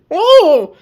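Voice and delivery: sudden high startled whoa; surprised whoa. Real, not acted. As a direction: caught off guard, yelp it. Camera mount suddenly swings.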